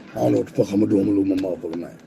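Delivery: usual speaking voice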